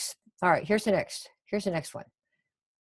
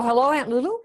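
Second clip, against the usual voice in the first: higher greeting, rising at the end; demo phone greeting; 6 semitones higher, 3 dB louder than usual. Shown as a demonstration. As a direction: lilt up on the aunt's name as you open a call. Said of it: "So you go".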